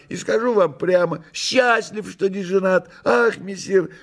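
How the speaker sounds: high, gushing theatrical cat voice; Behemoth the cat, effusive. Acted. Behemoth telling messire about his bachelor luck, high effusive voice. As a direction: ramble high, with grand sighing rhetoric.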